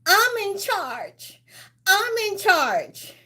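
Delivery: high, slow, drawn-out wail; wailing movie-quote impression; movie lady's line. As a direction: cry it out high and stretched. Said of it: "And the lady said".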